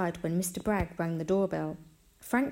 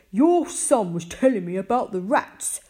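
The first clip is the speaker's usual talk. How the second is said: loud, pointed, icy complaint; 3 semitones higher, 9 dB louder than usual. A narrator's reading. Visitor telling mum about the rats, icily. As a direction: announce it with cold disapproval, loudly. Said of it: "Mister Bragg said icily".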